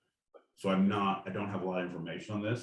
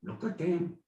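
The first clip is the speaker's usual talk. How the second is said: mock French general; 8 semitones higher than usual.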